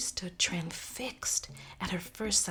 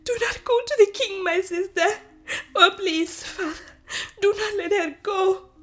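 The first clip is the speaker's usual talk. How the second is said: high, loud, sobbing plea; hysterical crying plea; 14 semitones higher, 11 dB louder than usual. Acted. Sister begging Nyasha not to see the king, hysterical sobbing. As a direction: beg through tears, frantic. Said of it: "she cried hysterically".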